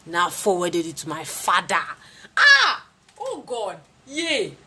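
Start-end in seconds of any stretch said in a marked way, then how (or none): 2.4-4.6 high, loud whoop of joy; gleeful cry. Real, not acted. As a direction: crow with triumph. Joy at revenge photo.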